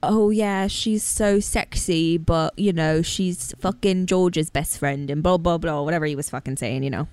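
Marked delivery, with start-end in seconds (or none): 0.0-5.1 slow, lightly put-on British accent. Host imitates Bear gossiping in a light British accent. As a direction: drawl out Bear's British accent. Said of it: "That's a very good accent".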